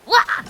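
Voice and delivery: short, high, loud "wah"; a sudden loud yelp; attack cry. Real, not acted. Streamer striking in the game, high loud attack yell.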